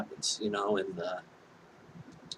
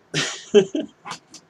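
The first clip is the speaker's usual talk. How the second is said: loud, high laugh; big burst of laughter; 12 semitones higher, 8 dB louder than usual. Real, not acted. Storyteller cracking up at the reveal, loud high laugh. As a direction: crack up at your own punchline. Punchline she has a penis.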